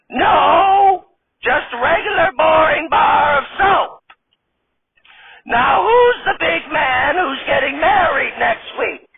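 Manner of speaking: high, slow, quavering granny voice; old lady voice. Acted. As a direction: play a sassy old grandma stripper.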